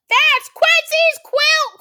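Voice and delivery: very high, loud duck squawk; quacking duck. Acted. Duck spotting stolen quilt, loud quacking voice. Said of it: "quacks Dottie".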